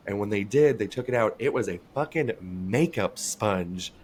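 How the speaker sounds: usual speaking voice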